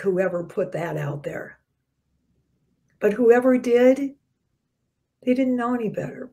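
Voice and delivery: ordinary talk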